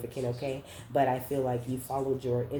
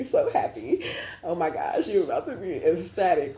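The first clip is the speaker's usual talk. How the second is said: laughing excitement; 5 semitones higher, 5 dB louder than usual. Real, not acted. Excited prediction for viewer.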